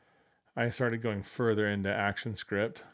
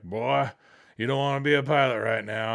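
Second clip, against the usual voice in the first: louder, lightly voiced veteran instructor; senior pilot; 3 semitones higher, 7 dB louder than usual. Senior pilots warning their student after nine eleven, emphatic mock warning. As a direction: imitate an old hand's warning, pushed louder. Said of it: "senior pilots that were my instructors all saying".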